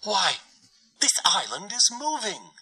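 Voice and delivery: high, bright startled cry of a little dove; a small bird crying out in sudden surprise; surprised cry. A narrator's reading. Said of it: "she cried".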